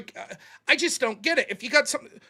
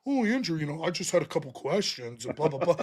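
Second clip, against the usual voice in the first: meek mock voice; 9 semitones lower, 8 dB quieter than usual. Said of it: "here's Andrew".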